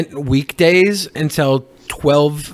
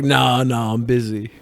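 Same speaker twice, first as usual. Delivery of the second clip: lazy dismissive God; 6 semitones lower, 4 dB quieter than usual. Retold or imitated. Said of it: "God's like".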